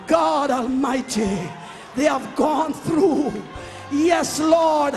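ordinary talk

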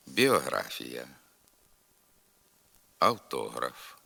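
isolated words with long pauses for repetition; repeat-after-me drill. Shown as a demonstration. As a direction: model each word carefully for learners to echo. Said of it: "Тепер повторюйте за мною". (навчальна вимова для повторення, з довгими паузами)

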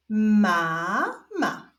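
stretched first syllable, pause, then second; drawn-out, divided syllables; syllable reading. Shown as a demonstration. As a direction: read it slowly as two separate syllables. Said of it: "zapraszam Was do odczytywania sylab".

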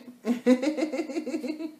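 high, loud rippling giggle; giggling laugh. Real, not acted. Vlogger amused after asking about lined lips, high giggle. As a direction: burst into a long, high run of laughter. Laughs at own lip-lining question.

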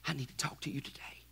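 soft whispered plea. Acted. God whispering to Drew amid distractions, whisper. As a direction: whisper it close and urgent.

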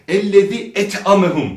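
"et'amehum" with a thin Turkish t; light t error. Shown as a demonstration. Quran teacher imitating fast readers, thin t. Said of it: "Bu da yanlıştır".

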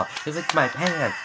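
ordinary talk, nothing marked

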